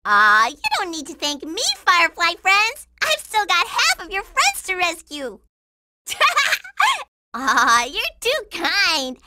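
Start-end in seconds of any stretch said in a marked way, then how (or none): 6.1-7.0 high, pleased little giggle. Acted. Girl thanked by firefly friends, high giggle. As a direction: let out a short high titter.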